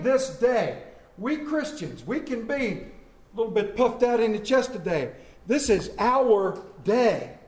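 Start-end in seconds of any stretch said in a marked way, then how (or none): 5.5-7.4 each word laid down slowly, stress on "our"; slow emphatic declaration. Shown as a demonstration. Pastor claiming Easter for his congregation, slow and emphatic. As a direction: drag it out, "our" weighted. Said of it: "And I say it like that".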